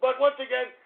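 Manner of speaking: normal talking voice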